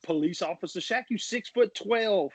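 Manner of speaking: high-pitched comedian impression; imitated comic's routine, stretching the punchline; Cat Williams. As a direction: pitch it way up, incredulous stand-up delivery. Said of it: "Cat Williams talking about".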